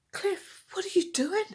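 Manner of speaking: big sister's voice. Acted. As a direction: voice the nosy older sister.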